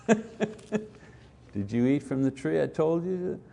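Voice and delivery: slow, calm questioning voice; gentle inquiring God. Retold or imitated. God gently asking Adam about the tree, calm and slow. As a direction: ask kindly, not accusing. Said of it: "It's that gentle voice".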